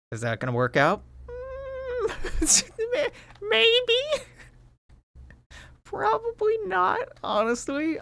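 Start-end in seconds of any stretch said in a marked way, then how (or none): 1.3-8.0 very high, squeaky hesitant voice; squeaky doubt. Real, not acted.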